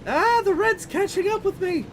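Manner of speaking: high-pitched loud panic; screaming as it closes in; scared horror game streamer. Real, not acted. Red storm catching up.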